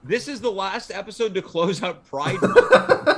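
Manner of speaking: barely holding back laughter as you read; laughing while reading. Real, not acted. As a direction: read it out through a laugh, slow and loud. Reading a chat message aloud.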